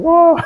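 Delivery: high imitated fan shriek; crowd scream. Imitating fans screaming at the reveal, high shriek. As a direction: shriek like the crowd when the members appear. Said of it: "Grabe, sigawan".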